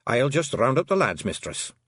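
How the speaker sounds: put-on a wee Scots rogue reassuring the girl; Scottish pixie. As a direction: Rob Anybody in a broad Scots pixie voice. Rob leaves.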